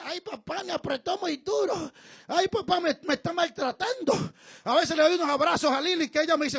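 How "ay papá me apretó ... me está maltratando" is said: much softer, small complaining voice; a hint of a squeezed little girl; daughter squeezed in a hug. Daughter protesting her father's hug, soft and whiny. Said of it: "que dice".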